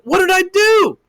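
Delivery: high, loud feigned innocence; squeaky mock what-did-I-do. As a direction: play the wronged innocent.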